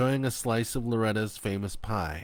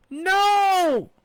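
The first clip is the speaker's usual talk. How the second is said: high, loud burst; shouting no; 12 dB louder than usual. A narrator's reading. Fanny shouting from the hole at the men. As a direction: blast a refusal. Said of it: "Fanny shouted".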